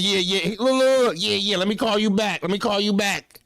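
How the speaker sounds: a father hurrying to get off the phone; imitating pops dodging. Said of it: "He told me".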